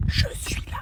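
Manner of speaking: ghostly whisper. Acted. Teacher haunting pupil's dream, eerie soft whisper. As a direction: breathe it like a ghost.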